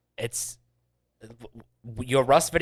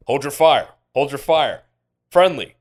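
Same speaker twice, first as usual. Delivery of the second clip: lightly voiced in-game call, raised volume; mock battlefield callout, louder; acted calling out; 2 semitones higher, 9 dB louder than usual. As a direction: call out briefly to an armed stranger. Acts out calling to enemy players.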